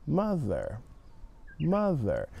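mother with a soft th, tongue just out; careful th. Shown as a demonstration. Teacher repeats mother softly for the class to copy. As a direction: model mother with the tongue barely between teeth. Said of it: "Your tongue's just going out a little bit".

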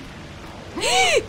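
very high, squeaked intake; alarmed gasp. Real, not acted. Player afraid of falling, high alarmed gasp. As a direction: gasp as if about to fall.

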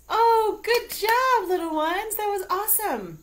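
high, loud gushing praise; overjoyed praise for the kids; excited praise. Acted. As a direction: bubbly cheer for the little ones. Praising children after shout.

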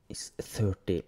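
usual speaking voice

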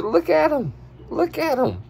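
high, amazed squeals; excited. Acted. Watching a cowboy in the video, high excited squeals. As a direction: point and marvel at the cowboy.

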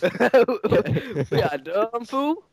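very slow, laughing drawl rising at the end; laughing mock. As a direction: laugh through it, stretching each word.

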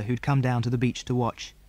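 usual speaking voice

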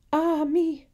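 high, loud stricken cry; anguished cry. A narrator's reading. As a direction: cry out as if just struck. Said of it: "cried Matilda".

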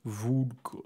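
thick, mangled Russian accent; Russian with braces. Shown as a demonstration. Player hamming it up for the table, Russian accent with braces. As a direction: garble it like a Russian wearing braces. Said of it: "sounds just like a Russian person with braces".

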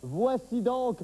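crown them with a booming introduction; mock royal presenter; grand announcer.

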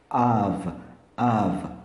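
"of" said with an A and a V. Shown as a demonstration. Teacher showing learners that "of" sounds like AV, clearly pronounced. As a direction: say "of" ending in a voiced V. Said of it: "um A e um V".

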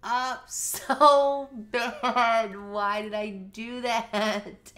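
high, loud drawn-out cry of dismay; real upset, loud and stretched out; distraught wail. Real, not acted. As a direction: wail in real panic over a blunder. Distraught at her own game mistake.

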